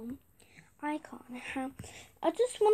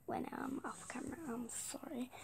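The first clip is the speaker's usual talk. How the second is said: whisper; 4 semitones lower, 20 dB quieter than usual. Real, not acted. Whispering to herself.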